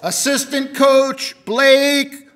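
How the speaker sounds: loud, slow, drawn-out name call; radio announcer voice. Pretend. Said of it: "sound like my best radio announcer's voice".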